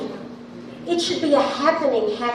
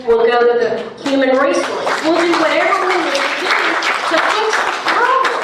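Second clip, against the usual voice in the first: rising, louder and faster as she vows to fix it; heated outburst; 6 semitones higher, 4 dB louder than usual. Real, not acted. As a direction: voice climbing in frustration, pushing hard. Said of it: "I have a big voice".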